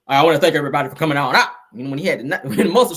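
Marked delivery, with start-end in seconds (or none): 0.1-1.5 loud, very fast, lower mock preacher voice; Eddie Long. Host demonstrates how his voice was, loud rushed imitation. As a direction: blurt the thanks in a rushed put-on voice. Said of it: "his voice was like this".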